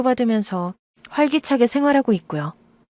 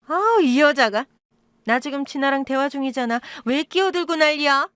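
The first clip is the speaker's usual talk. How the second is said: higher, annoyed outburst; irritated snap; 6 semitones higher than usual. Acted. Birth mother snapping at the woman who interrupts, higher irritation.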